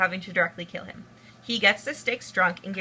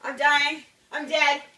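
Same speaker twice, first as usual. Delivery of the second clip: hammed-up death; 6 semitones higher than usual. [name] dies dramatically.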